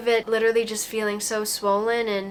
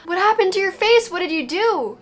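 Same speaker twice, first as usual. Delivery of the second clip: high, loud shocked gasp of a relative; shocked exclamation; 8 semitones higher, 5 dB louder than usual. Retold or imitated. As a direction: blurt it out in shock. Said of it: "they went".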